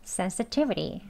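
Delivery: "sensitivity" quick and relaxed, "sensitivri"; quick native "sensitivity"; fast flapped. Shown as a demonstration. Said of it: "빨리 발음하셔서".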